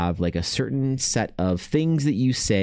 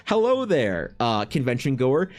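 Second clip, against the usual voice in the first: high, stiff overly formal pitch; mock salesman greeting; 4 semitones higher than usual. Streamer imagining pitching his show to a convention goer, high hammed-up greeting. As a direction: ham up an awkward booth greeting.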